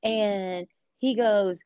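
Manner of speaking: ordinary talk, nothing marked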